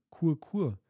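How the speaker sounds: high cooing "Kur, kur"; dove coo. A narrator's reading. Doves calling from their nest, high coo. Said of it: "Da sagten die Waldtauben".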